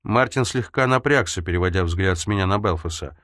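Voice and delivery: plain reading voice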